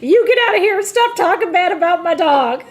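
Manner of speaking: high, loud mock-outraged Southern lady; imitated yelling. Older lady defending her bear to videographer, high hammed-up yelling. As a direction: yell it through amusement, imitating a defensive old lady. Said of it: "she starts yelling at the videographer".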